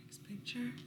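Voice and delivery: hushed aside; just breathe it; whisper. Real, not acted. Reader whispering to turn the page.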